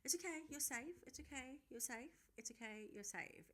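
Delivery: soft, fading, repeated reassurance; calm her down like a panicking child, softly; soothing reassurance. Retold or imitated. Friend on the phone soothing a panicking caller, soft reassurance. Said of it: "God love Izzy is still saying".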